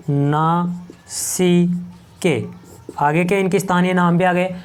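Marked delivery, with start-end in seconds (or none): 0.1-1.6 very slow नासिके with gaps between syllables; syllable-by-syllable dictation. Shown as a demonstration. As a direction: break नासिके up as if writing it. Dictating the term while writing.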